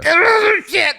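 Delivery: high, loud panicked scream; shrieked mock alarm, then a curse. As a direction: scream in comic terror. Acts out panic before being killed.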